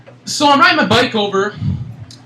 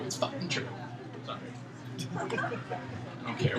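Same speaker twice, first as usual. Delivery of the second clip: whispered aside; 13 semitones higher, 20 dB quieter than usual. Real, not acted. Swearing near a child.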